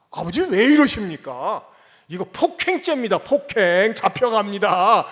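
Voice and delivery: 크게 따지는 가상의 항변; 아버지에게 크게 항의하듯; mock loud protest. 이삭이 할 수 있던 항변. (아들이 아버지에게 크게 따지는 가상 항의)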